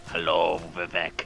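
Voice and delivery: low, menacing reaper greeting; grim reaper voice. Pretend. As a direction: greet him low like death itself. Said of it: "I look like death".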